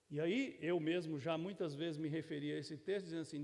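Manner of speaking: normal talking voice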